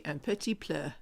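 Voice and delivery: "un petit peu" with a stray L in "peu"; mimicked bad French. Wife teasing husband about his old French, mimicked mispronunciation. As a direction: mangle "peu" into "pluh" in "un petit peu". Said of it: "you used to say".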